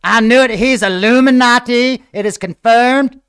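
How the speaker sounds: conspiracy fan crowing over proof; mock excited shout. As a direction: shout it like you caught him. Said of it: "they'll be like".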